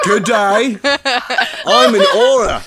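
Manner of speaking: high, loud hammed-up Australian accent; laughing, over-the-top Aussie accent. Friend joking after accents come out Australian, loud mock Australian accent. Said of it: "It's going Australian".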